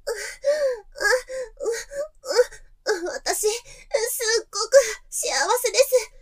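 high, breathless, moaning between words; aroused gasping. Acted. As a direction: pant through each phrase with pleasure. Lovemaking, gasping. (高い声で喘ぎながら)

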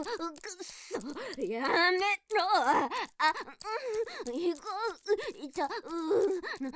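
helpless moaning. Acted. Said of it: "私はこんな声出さねえ".

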